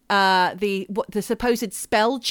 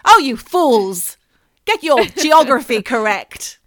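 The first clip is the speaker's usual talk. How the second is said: yelling; 3 semitones higher, 7 dB louder, slower than usual. Retold or imitated. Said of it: "people yelling at the transistor radio".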